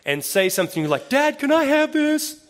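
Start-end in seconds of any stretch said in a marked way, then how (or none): 1.1-2.4 put-on high, far whining kid's voice; whiny child. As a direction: beg like a small child. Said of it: "child come up to you".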